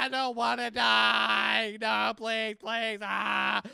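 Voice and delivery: hammed-up shouted pleading; loud panicked begging. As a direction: beg loudly not to die. Pleading while screaming in game.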